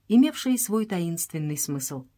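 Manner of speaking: ordinary delivery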